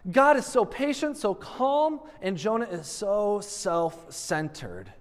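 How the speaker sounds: normal talking voice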